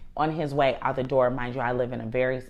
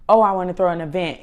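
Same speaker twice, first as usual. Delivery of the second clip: fast, louder eager pitch of an idea; rushing out the idea brightly; excited friend; 3 semitones higher, 9 dB louder, faster than usual. Retold or imitated. Mother mimicking her friend, quick and eager. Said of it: "was like".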